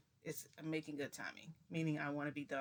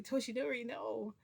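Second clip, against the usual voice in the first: high, louder protest; retold pleading; 7 semitones higher, 6 dB louder than usual. Storyteller begs Toshinori not to shoot, alarmed plea. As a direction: beg him to stop.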